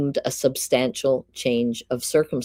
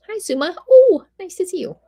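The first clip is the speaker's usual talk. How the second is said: high, bright burst of hello, much higher and louder; excited greeting; 7 dB louder, faster than usual. Real, not acted. Streamer spots a viewer in chat, excited high greeting. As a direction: squeal hello to a friend who just arrived.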